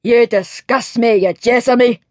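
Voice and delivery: fiery Highland cook growling; irate Scottish growl. A narrator's reading. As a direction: growl it in disgust at the footman. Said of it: "growled Angus McGregor".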